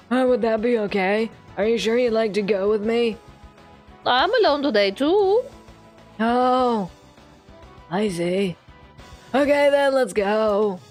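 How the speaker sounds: lightly voiced old teacher; old man voice. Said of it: "he's still getting the old man voice".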